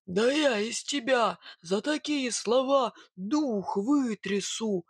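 slow, drawn-out menacing growl; angry bogatyr threatening. Acted. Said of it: "угрожающе прорычал Илья".

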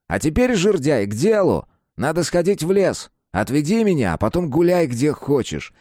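put-on a dead teen giving orders; teen boy voice. Kapustin speaks.

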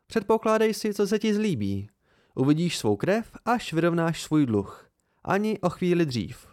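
firm, high-voiced refusal with falling endings; defiant Devi. A narrator's reading. Devi refusing the narrator until the debt is paid, defiant.

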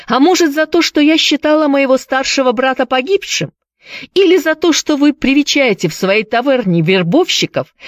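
high-pitched, scornful tirade; indignant. A narrator's reading. Priscilla lists her grievances to Gibbs, indignant. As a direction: pile up accusing questions, voice high.

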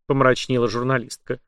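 usual speaking voice

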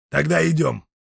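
firm and decided; resolute. Acted. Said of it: "решительно сказал капитан".

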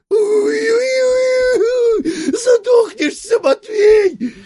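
squealing. A narrator's reading. Merchant squealing on the floor at Matvei. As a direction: shriek it as if scalded. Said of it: "катается по полу и визжит".

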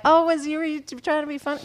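a lightly voiced stern ride attendant; imitating the lady. Wife imitates the ride lady, lightly voiced. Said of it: "The lady was like".

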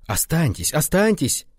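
pleading protest, rising on the repeat; urgent objection. A narrator's reading. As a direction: beg her to stay. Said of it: "возразил он".

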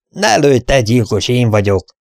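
plain reading voice

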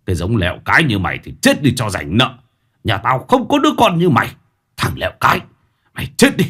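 put-on a drunk father's cruel curse, rising to a shout; cold contempt. A narrator's reading. Drunk father cursing his son as he rows away, cold then yelling. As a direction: spit it out with disgust, then yell. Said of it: "bỏ lại một câu".

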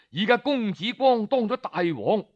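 plain reading voice

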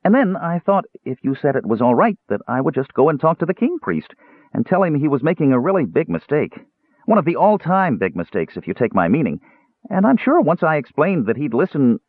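high, rambling, earnest kender; chatty kender. Acted. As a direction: pitch it high and ramble on hopefully. Voices the kender rambling his plan.

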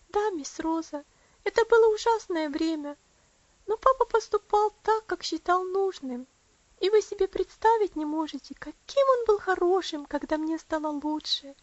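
put-on high, emotional child's voice; earnest little Elsie. Elsie recalling her illness to Rosa, earnestly.

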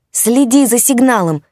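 loud, high urgent shout. A narrator's reading. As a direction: shout across the noisy room. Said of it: "пытался докричаться он".

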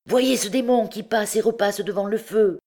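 high-pitched exclamation; crying out. A narrator's reading. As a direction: cry it out high, pointing something out to the others. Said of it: "s'écriait le duc d'Égypte".

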